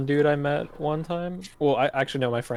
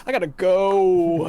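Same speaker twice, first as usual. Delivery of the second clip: louder, drawn-out comic escape line; mock hasty excuse; 7 dB louder than usual. Exposed faker excuses himself to the friend, comic panic. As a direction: imitate a caught faker bailing out.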